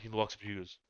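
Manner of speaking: ordinary delivery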